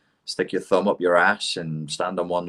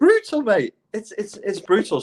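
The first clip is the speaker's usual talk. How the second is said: laughing reaction; 12 semitones higher than usual. Real, not acted.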